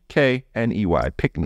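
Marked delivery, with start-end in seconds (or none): 1.2-1.5 pickney said PICK-nee, short i, no k before the n; Jamaican word pickney. Shown as a demonstration. Jamaican husband teaching his wife the word pickney. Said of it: "so pickney".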